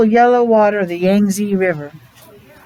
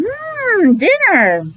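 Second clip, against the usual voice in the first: hungry hmm; 10 semitones higher, much slower than usual. A narrator's reading. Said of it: "They're looking at Ping saying".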